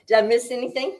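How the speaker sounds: ordinary talk, nothing marked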